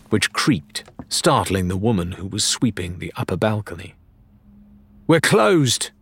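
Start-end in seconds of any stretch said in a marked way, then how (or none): 5.1-5.9 curt, cross call from above; cross barmaid calling down. A narrator's reading. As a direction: snap it shut, annoyed. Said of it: "she said crossly".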